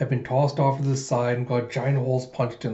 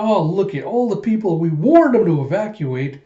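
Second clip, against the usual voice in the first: higher, louder outcry; exclaiming; 5 semitones higher, 5 dB louder than usual. Acted. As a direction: exclaim over the crowd. Character exclaims at fleeing crowd.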